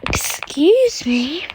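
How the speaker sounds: drawn-out indignant question; mock indignant. Teller replays answering name-calling, indignantly. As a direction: stretch it right out in put-on offence. Acted retort to being insulted.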